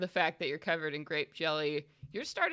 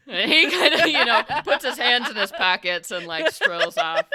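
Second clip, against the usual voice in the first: loud, giggling description; laughing narration; 7 semitones higher, 10 dB louder than usual. Real, not acted. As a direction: tell it while cracking up.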